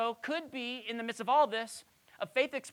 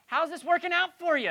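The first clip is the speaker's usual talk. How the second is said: pointed, higher and louder; a higher, louder sarcastic dig; cultural jab; 10 semitones higher, 8 dB louder than usual. Retold or imitated. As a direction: needle them with it. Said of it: "is how we say it in our culture".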